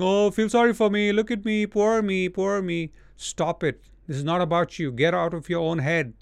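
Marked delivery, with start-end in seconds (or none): 0.0-2.9 higher, whiny "poor me" voice; mock self-pity. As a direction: ham up a pitiful complainer. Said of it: "people are whining, crying, grumbling".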